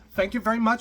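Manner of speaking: high, loud accent impression; Doctor Ruth. Passenger thanking her driver, high mock accent. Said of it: "I'm doing a horrible accent here".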